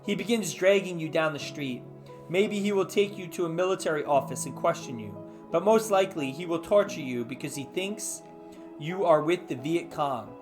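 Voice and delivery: ordinary talk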